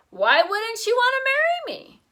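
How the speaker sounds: put-on a child who can't imagine a no; puzzled little boy. A narrator's reading. Fudge indignant child.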